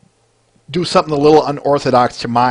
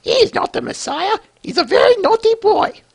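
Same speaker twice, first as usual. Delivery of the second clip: much higher, scolding falsetto mum; high shrill mother impression. Mother scolding the crowd about her son, high falsetto. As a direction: shriek it like an indignant mother. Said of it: "I know that wasn't the greatest".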